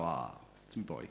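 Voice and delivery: low, soft fatherly fondness; tender dad. Retold or imitated. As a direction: melt quietly over your little boy. Doting dad answering his son.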